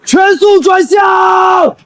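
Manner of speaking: drawn-out shouted order; commanding shout. Acted. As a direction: bark the order out slowly. Captain's order at full force.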